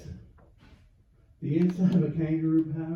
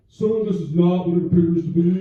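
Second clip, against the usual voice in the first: loud, stiff fake-calm excuse; hammed-up official tone; mock authority; 11 dB louder than usual. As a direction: bluff your way out like a dad. Caught by ranger, lame excuse.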